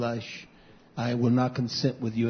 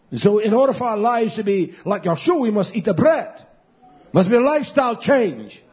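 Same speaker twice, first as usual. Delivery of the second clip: higher and louder, driving the point home; full-force preaching; 9 semitones higher, 7 dB louder than usual. Acted. Pastor urging the congregation to change their lifestyle, preaching loud. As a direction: proclaim it like a climax of the sermon.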